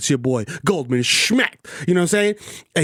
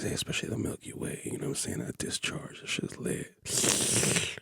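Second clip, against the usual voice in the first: breathy whisper, far softer; 7 semitones lower, 14 dB quieter than usual. Pretend. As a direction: lean in and whisper it dirty. Drops to a whisper.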